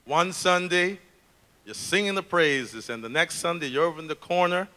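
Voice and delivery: measured, dramatic monologue of a care-home resident; poem recital. Acted. Care-unit resident airing grievances to the audience, dramatic poem recital. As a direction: recite it with wry, weary complaint and deliberate pauses.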